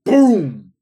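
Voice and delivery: loud shouted boom sound effect; mock explosion. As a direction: blurt it like a bomb going off. Mock explosion after match listing.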